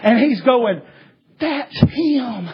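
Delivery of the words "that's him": frightened whisper. Retold or imitated. Scared guard points out Satanist.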